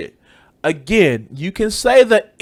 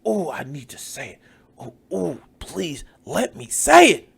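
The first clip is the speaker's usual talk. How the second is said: soft moaning plea, rising at the end; mock craving; 4 dB quieter than usual. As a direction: whimper with exaggerated need. Mocking someone craving to say it.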